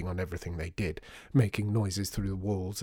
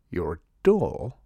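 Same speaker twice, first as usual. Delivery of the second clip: louder, startled question; surprised; 3 semitones lower, 5 dB louder than usual. A narrator's reading. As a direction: caught off guard. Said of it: "now sounding more surprised than miserable".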